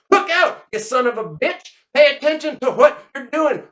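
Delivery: high, loud scolding; shouted warning. Acted. Man nearly hit by gun barrel, shouted scolding. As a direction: snap a sharp warning to a careless shooter.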